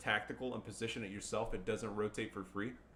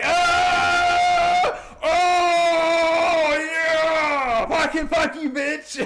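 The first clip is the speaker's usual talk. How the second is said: screaming, much higher and louder, laughing in victory; triumphant yell; 16 dB louder, much slower than usual. Real, not acted. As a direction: roar in wild triumph after a double wipe. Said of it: "I'm like".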